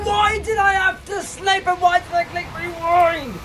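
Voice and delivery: high, slow, wailing why did I click rewind; real anguish, pitched far up; anguished shout. Real, not acted. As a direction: cry out at your own mistake. Same words, now shouted.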